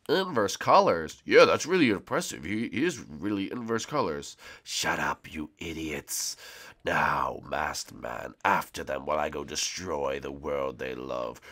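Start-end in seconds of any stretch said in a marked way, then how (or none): 4.6-6.4 low menacing boss; villain voice. Acted. 6.8-11.4 low menacing boss; villain voice. Acted. Villain gives orders.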